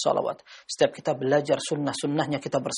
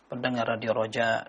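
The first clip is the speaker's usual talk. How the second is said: normal talking voice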